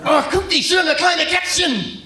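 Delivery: hammed-up mad German; angry German. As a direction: bark the sweet words like an enraged German. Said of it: "they always sound so mad".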